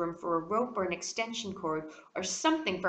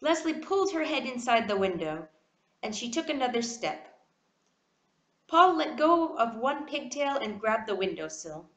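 plain reading voice

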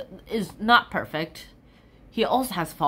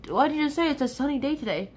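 ordinary talk, nothing marked

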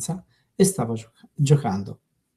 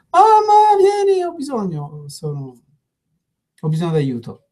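child's fake shout; 5 semitones higher than usual. Child with no real problem calls mother, high fake shout. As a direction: shout for mom like a kid faking trouble. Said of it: "senza un vero problema, gridò".

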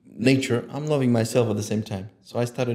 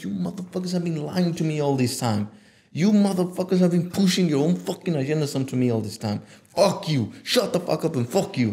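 higher-pitched angry tirade; acted anger; 5 semitones higher than usual. Showing listeners how to get angry, indignant outburst. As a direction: curse them out for lying to you. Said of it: "you need to get angry".

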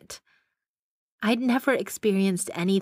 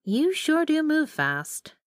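higher, slow sarcastic jab; 9 semitones higher, slower than usual. A narrator's reading. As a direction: drag it out with bitter sarcasm. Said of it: "and grunted".

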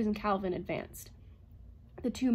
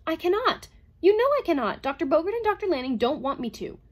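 high, loud distressed outburst; agitated robot protest, pitched high; sudden blurt; 8 semitones higher, 8 dB louder than usual. A narrator's reading. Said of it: "The robot blurted out suddenly".

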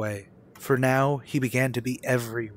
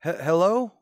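nervous stammer; 6 semitones higher, 3 dB louder than usual. Acted. Nervous call home to mother.

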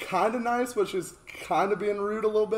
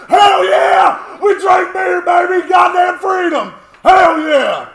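whooping, much higher and louder; hyped shouting; 10 semitones higher, 11 dB louder, much slower than usual. Acted. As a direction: yell it like a rowdy party. Celebrating freedom, shouting at neighbors.

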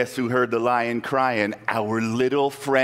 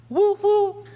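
very high gleeful woo; whoo; 5 dB louder than usual. A narrator's reading. Narrator cheers as the net breaks open, very high. Hole made.

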